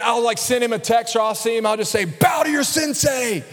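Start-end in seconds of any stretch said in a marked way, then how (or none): 2.1-3.4 high, drawn-out movie-quote taunt; hammed-up martial arts master's order; mock sensei command. Teasing a jujitsu friend with a film line, mock command.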